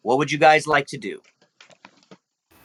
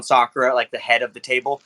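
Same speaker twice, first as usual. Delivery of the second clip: normal talking voice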